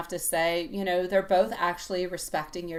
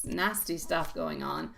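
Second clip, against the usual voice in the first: ordinary talk, nothing marked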